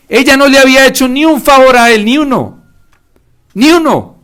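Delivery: high, loud, pleading sermon climax; rising passionate preaching; impassioned preaching. Real, not acted. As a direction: cry it out with fervor.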